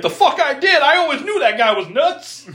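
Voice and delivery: high, loud outraged yell; furious outburst. Retold or imitated. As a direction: explode in indignation. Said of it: "He's like".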